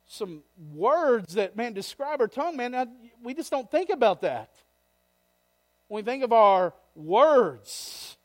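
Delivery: loud, high, impassioned pulpit delivery; fiery preacher; full-force preaching. Acted. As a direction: preach it out to the back row.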